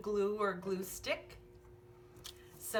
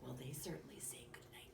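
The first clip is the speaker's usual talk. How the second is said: soft musing whisper; 5 semitones lower, 15 dB quieter, faster than usual. Acted. Remarking to the child in a whisper.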